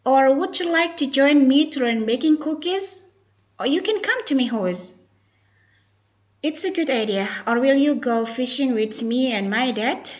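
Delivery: slow, careful, projected reading; model dialogue reading. Shown as a demonstration. Teacher reads out invitations for students, slow and clearly projected. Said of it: "focus to pronunciation and intonation".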